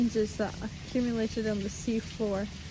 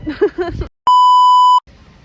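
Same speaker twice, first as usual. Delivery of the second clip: high burst of laughter; loud shrieking laugh; 18 dB louder than usual. Real, not acted. Laughs after sneeze.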